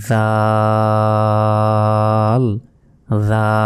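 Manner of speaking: tongue tip at the teeth, hold the vowel of জাল very long; sustained Arabic dhal in জাল. Shown as a demonstration. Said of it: "দাঁতের আগার সঙ্গে লাগিয়ে".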